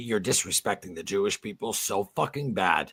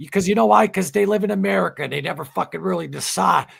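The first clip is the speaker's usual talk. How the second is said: high, loud heated rant; real rising anger; getting mad; 8 semitones higher, 4 dB louder than usual. Real, not acted. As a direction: let the anger build as you go. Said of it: "I'm getting mad now".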